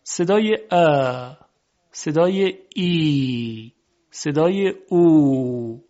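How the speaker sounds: slow demo, "ا", "ای", "او" each held long; long vowels. Shown as a demonstration. Teacher showing unobstructed sounds, "ا", "ای", "او" drawn out. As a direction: stretch the vowels "ا", "ای", "او" out freely. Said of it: "صدای کشیده هستن بدون هیچ".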